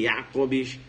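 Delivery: "Jacobs" with a Y sound, YA-cobs; German pronunciation. Shown as a demonstration. Teacher names the author for the class, German pronunciation. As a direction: say "Jacobs" starting "iá", not with J.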